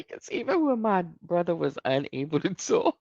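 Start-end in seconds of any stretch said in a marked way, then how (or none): none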